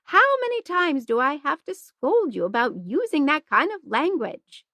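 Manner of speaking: high, scolding nun; a nun scolding a girl's swearing, high and sharp; shrill scolding. A narrator's reading. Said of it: "in a shrill voice".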